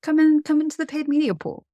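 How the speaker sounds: high, inviting call into the pool; playful invite. Real, not acted. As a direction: welcome them in like a showman.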